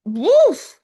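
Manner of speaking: high, loud "woof"; swooning at the view; real delighted exclamation; excited. Real, not acted. Raving about the coast.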